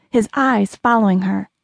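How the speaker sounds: usual speaking voice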